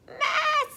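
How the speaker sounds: high maa; goat bleat. A narrator's reading. Narrator voicing a goat, high bleat. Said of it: "said a goat".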